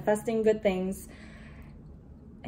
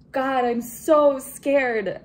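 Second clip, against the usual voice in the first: loud, slow, higher cry of fear; scared outcry; 5 semitones higher, 9 dB louder, much slower than usual. Real, not acted. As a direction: wail out how scared you are, slowly. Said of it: "And I'm so scared".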